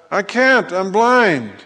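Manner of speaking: protesting. Acted. Blind man answering Jesus' order to wash, mock protesting. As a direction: plead helplessness in a high voice.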